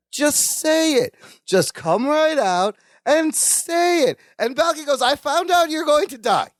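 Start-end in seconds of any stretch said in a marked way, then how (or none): 0.1-4.2 high, slow, emphatic mock urging; drawn-out coaxing. Imitating Larry urging Balky to speak, drawn-out coaxing. 5.0-6.5 blurted confession. Retold or imitated. Balky blurting news, acted.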